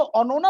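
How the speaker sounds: "ओनोना" with rounded o vowels; Bengali-sounding "ओनोना"; Bengali pronunciation. Shown as a demonstration. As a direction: say "ओनोना" like the Bengali version of Ananya. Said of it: "ओनोना लिख कर आता".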